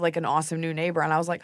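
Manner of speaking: ordinary talk, nothing marked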